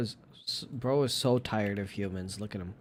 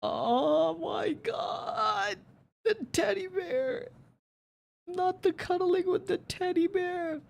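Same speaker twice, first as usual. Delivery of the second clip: very high, overwhelmed squeal; slower than usual. Real, not acted. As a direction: melt over the cuddly cat. Overwhelmed by teddy bear cuddling.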